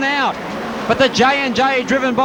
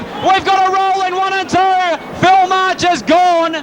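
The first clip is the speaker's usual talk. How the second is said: excited; 7 semitones higher than usual. Real, not acted. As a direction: call the rollover urgently. Rollover called live.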